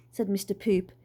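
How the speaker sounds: ordinary delivery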